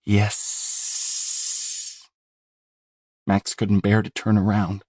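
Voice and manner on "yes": breathy, lisping monster whisper. Acted. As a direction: hiss it out like a rotting thing. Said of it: "while it lisped".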